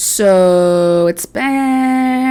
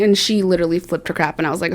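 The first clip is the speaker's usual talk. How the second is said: normal talking voice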